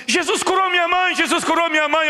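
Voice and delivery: high, excited cry of joy, repeated; a daughter shouting that her mother is healed. Retold or imitated. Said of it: "ela falou assim". (grito de alegria, bem agudo)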